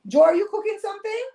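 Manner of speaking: high-pitched call, rising sharply at the end. Real, not acted. Asking Joe in the room about a noise, calling out. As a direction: call it out high and quick as a question.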